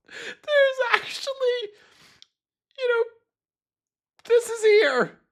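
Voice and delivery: very high, squeaky, laughing through the words; losing it completely; real uncontrollable giggles; hysterical laughter. Real, not acted. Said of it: "I'm laughing so hard".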